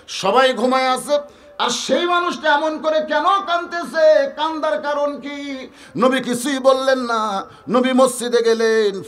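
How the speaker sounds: high-pitched sermon storytelling; impassioned preaching. Acted. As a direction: preach the question out to the crowd. Preacher at full force.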